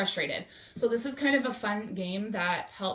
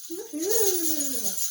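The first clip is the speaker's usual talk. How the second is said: cheer; 7 semitones higher than usual. Real, not acted. Cheer after the dance.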